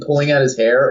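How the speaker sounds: ordinary talk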